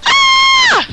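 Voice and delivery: high, loud acted scream. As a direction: scream in sudden fright. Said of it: "I scream".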